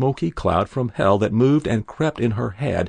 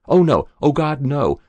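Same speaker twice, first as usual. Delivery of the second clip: higher, louder cry of alarm; sudden dismay; 5 semitones higher, 5 dB louder than usual. A narrator's reading. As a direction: recoil from a dreadful realization. Sudden dismay at a dreadful realization.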